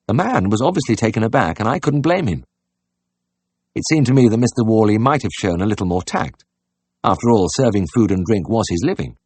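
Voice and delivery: usual speaking voice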